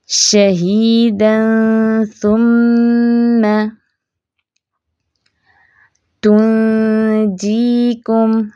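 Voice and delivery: very slow, light thin ghunna with lips stretched; light ghunna ikhfa. Shown as a demonstration. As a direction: draw it out with a fine nasal. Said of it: "होंठ थोड़े से stretch करें". (धीमा, बारीक गुन्ना)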